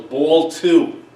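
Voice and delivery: loud, lightly imitated umpire; umpire's call. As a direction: announce the pitch like an umpire. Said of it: "he takes off the mask".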